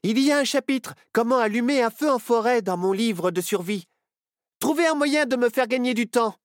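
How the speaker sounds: excited, high-pitched planning; rally them urgently round the new idea; sudden inspiration. A narrator's reading. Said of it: "s'illumina Nao". (idée soudaine lancée avec excitation)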